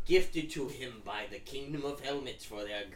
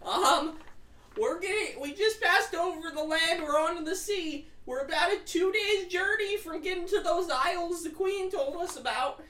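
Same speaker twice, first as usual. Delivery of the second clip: high, bright narration voice; high squeaky voice; 4 dB louder than usual. Real, not acted.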